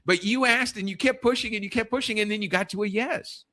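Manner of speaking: a hint of a bright young daughter giving advice; quoting daughter. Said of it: "She says".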